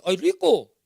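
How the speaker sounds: put-on child saying "rico" as "liko"; natural repeat of the faulty "rico"; son copying mistake. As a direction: echo "rico" with an L for R. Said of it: "hijo, naturalmente".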